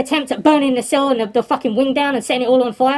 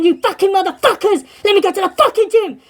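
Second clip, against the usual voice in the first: angry mock yell, pitch raised; retold screaming; 6 semitones higher than usual. Prisoner demands to go to the gym, retold screaming. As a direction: imitate a raging shout without full volume. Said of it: "He's still screaming, shouting, banging".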